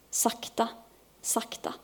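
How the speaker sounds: very soft, nearly whispered; hushed and careful. A narrator's reading. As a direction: tiptoe through each word in a hush. Narrator acts out careful slow steps. (mycket tyst och försiktigt)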